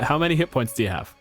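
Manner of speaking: usual speaking voice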